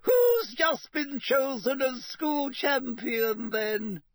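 high, fluting portrait lady; gossipy high lady, drawn out; fat lady voice. Acted. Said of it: "said the fat lady".

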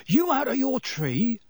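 high-pitched startled yelp; alarmed yelp. A narrator's reading. Conductor shocked at the forbidden name, high yelp. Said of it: "yelped Stan".

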